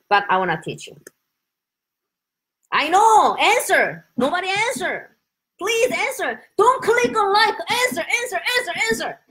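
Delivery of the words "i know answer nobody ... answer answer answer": high, insistent pleading; playful pestering. Real, not acted. Teacher begging silent viewers to reply, high playful pestering. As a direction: nag them for an answer, pitched high.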